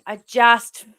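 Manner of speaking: normal talking voice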